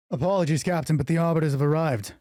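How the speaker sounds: loud, high, urgent soldier; urgent report. Acted. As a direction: announce it breathlessly.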